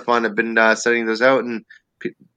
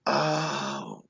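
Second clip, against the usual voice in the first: soft, slow, sheepish; sheepish realization; 5 semitones higher, 9 dB quieter, much slower than usual. Retold or imitated. Comic meekly realizing the wife is right. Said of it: "And I'm like".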